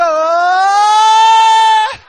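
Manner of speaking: long, loud rising scream; drawn-out high shriek, acted; imitated child's scream. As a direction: wail out like a panicking boy. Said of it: "he begins to go".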